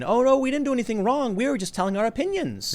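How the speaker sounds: high-pitched mock protest; exaggerated, put-on not-guilty voice; feigned innocence. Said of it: "where they can still feign".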